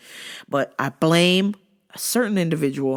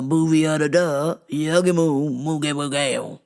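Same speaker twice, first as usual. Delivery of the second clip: imitating gibberish. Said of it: "just some crazy gibberish".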